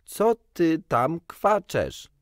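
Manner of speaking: slow and clear. A narrator's reading. Peter slowly repeating his question to the bird, slow and loud. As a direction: stretch every word so the bird gets it. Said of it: "starając się mówić wolno i wyraźnie".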